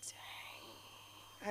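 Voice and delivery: under her breath, stunned; a hushed private dang; whispered dang. Retold or imitated. Reacting to her mom's answer, whispered dang.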